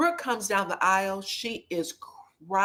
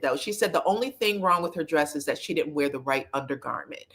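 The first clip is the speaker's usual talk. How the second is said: ordinary delivery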